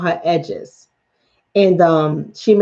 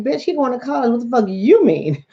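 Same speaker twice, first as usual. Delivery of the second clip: higher-pitched incredulous comeback; unspoken blunt retort; 6 semitones higher than usual. Retold or imitated. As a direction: act out what you'd really like to tell them. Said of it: "But I don't say nothing".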